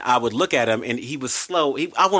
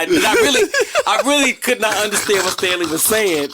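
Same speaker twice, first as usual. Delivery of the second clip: laughing through it; 9 semitones higher, 4 dB louder than usual. Real, not acted. Laughing retelling of confusion.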